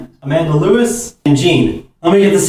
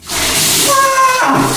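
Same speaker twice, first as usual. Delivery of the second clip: shocked scream. Real, not acted. Bucket poured.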